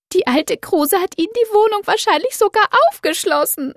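very high, indignant accusation; shrill with outrage; upset young woman. Acted. Doro accusing neighbour.

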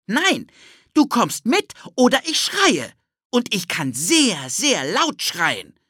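put-on high boy voice, slowing on the last words; cheeky threat. Ted threatens to scream, high boy voice slowing down. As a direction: tease him with a mock threat.

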